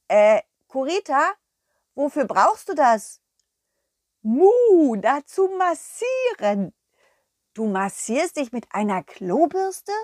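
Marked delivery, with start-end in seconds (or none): 4.2-6.7 high, mooing cow with a proud tone; a sweet cow showing off her find; cow puppet. Acted. The cow Kurita proudly presenting her little plate.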